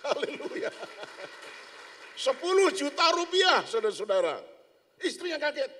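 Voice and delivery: high, loud exultant preaching. Real, not acted. Preacher exultantly telling the congregation about ten million. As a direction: proclaim it to the congregation.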